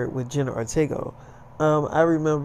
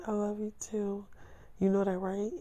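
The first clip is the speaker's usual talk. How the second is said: put-on tiny, high, hushed girlfriend voice; loving girlfriend; 5 semitones higher, 12 dB quieter than usual. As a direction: answer tenderly in an imitated girl's voice. Voices girlfriend returning his love.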